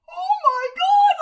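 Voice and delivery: husband's shocked cry. Retold or imitated. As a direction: shriek as the shocked husband seeing it. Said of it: "He's like".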